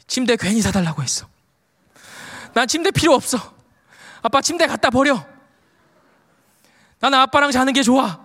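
imitating an upset child, pitch rising; mild mock wail of a child; retold tearful protest. Son protesting to dad about the bed, mildly tearful. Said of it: "서럽게 울기 시작하는 거예요".

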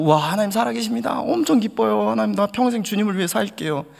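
high, fast, gushing joy; mock elation. Pastor mimicking a shallow believer's elation, high fast gush. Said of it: "이렇게 기뻐한다는 것은요".

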